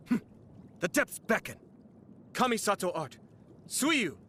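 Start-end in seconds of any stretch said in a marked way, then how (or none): 2.3-4.2 high, forceful attack name; commanding high-pitched combat cry; battle technique call. Acted. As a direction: call out your special move, pitched high.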